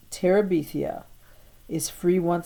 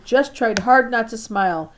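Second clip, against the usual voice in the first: usual speaking voice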